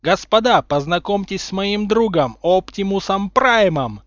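loud, high triumphant introduction; elated proclamation, drawn out on the name; joyful exclamation. A narrator's reading. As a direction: announce it gleefully like a showman. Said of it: "радостно воскликнул Гарри".

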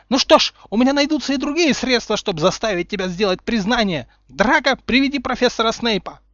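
high, loud threatening voice; threaten, then give a sharp order; angry threat. A narrator's reading. Umbridge threatening Harry with Snape, angry.

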